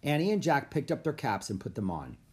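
ordinary delivery

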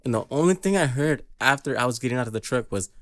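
normal talking voice

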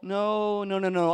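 normal talking voice

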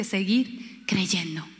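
slow whispered line. Acted. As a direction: whisper it as a secret. Stressing silence before God. (susurrado y lento)

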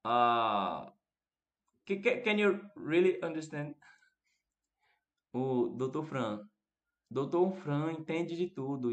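ordinary delivery

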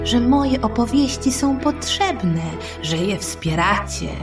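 plain reading voice

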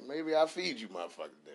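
usual speaking voice

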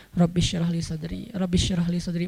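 low, soft supplication under the breath; mumbled prayer. Acted. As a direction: murmur the prayer to yourself. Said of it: "sih komat-kamit".

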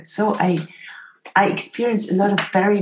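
ordinary talk, nothing marked

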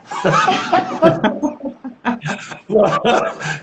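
long, high burst of laughter; drawn-out amused laughing fit; hearty laugh. Real, not acted. Laughs at his own year joke.

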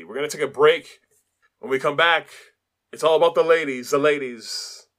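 hyped announcer. Acted. Hyping the next segment.

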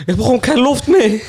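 high, loud fake panic; mock gasping for air. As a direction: pretend you're drowning. Joking about nearly drowning.

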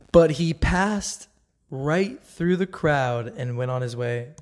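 slow, weighty reading of the verse; slow scripture reading. Shown as a demonstration. Reading the verse aloud to viewers, slow and weighty. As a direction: lay each word down slowly. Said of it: "This is verse thirty in Luke four".

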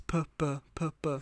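"P, b, p, b" as puh, buh, puh, buh; contrasted p and b. Shown as a demonstration. Teacher showing the last pair with lips, "P, b, p, b" sounded out. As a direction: alternate "P, b, p, b" as separate lip sounds. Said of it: "the last one is p and b".

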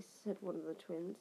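usual speaking voice

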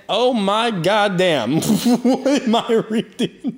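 high, slow, laughing through words; laughing disbelief. Real, not acted. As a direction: crack up reading it. Laughing disbelief while reading.

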